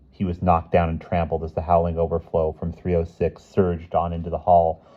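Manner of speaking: ordinary delivery, nothing marked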